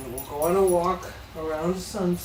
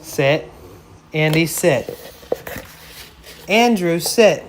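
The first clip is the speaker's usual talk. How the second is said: firm loud command; 10 dB louder than usual. Real, not acted.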